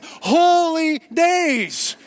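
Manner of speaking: "Holy days" very slow, high and loud; stress on Holy days. Shown as a demonstration. Preacher showing where holidays comes from, emphatic "Holy days". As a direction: proclaim "Holy days" as two long separate words. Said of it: "that's where that comes from".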